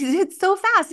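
high excited squeal. Real, not acted. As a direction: squeal it out, thrilled. Excited laughing about speed.

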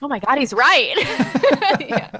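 laughing while agreeing; amused, high laugh. Real, not acted. Laughs at the impression.

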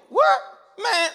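high, loud incredulous What?. Acted. Preacher acting out a street Greek reaction, incredulous. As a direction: squawk it in disbelief. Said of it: "in street Greek".

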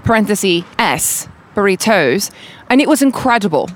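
put-on a light British accent on a review read aloud. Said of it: "I can hit you with, like, a British accent".

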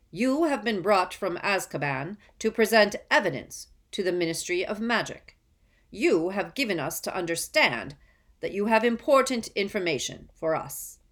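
loud, stern ministry official; curt official. A narrator's reading. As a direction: address the prisoner coldly. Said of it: "a curt voice".

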